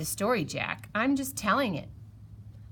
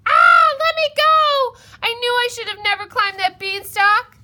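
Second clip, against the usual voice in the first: panicked scream; 13 semitones higher, 9 dB louder than usual. Acted. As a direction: scream as the giant grabs you. Giant snatches Jack up.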